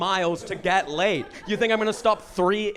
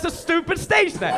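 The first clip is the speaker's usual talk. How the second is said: higher, scoffing tease at the name; mocking put-down; 9 semitones higher than usual. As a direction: sneer at the silly stage name, pitched up. Mocking reply to stage name.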